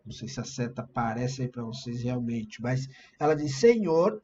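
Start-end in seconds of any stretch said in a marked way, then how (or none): 3.6-4.2 raised, louder retold call; mock shout, pitched up; imitated cry. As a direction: call out higher and louder, quoting her plea.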